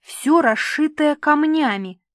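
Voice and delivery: high, loud, drawn-out delight; jubilant. A narrator's reading. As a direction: crow it slowly like a thrilled child. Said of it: "ликовала Крися".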